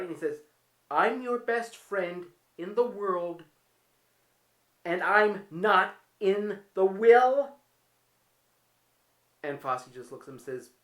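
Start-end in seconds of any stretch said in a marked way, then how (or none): 0.9-7.5 loud, slow and wounded, each word dragged out; a betrayed best friend making his point, loud and slow; indignant outburst. Retold or imitated. Paddy indignant, left out of will.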